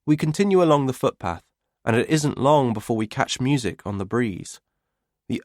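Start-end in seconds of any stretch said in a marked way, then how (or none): none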